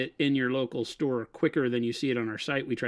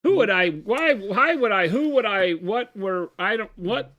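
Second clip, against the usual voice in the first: high, loud, sputtering protest; flustered; 7 semitones higher, 7 dB louder than usual. Real, not acted. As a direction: splutter in disbelief. Flustered denial of taunting.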